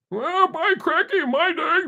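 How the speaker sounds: put-on high, slow creaky old-timer voice; by cracky, like a cranky grandpa; old geezer. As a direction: play a crotchety old man grumbling. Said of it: "that makes me sound really old".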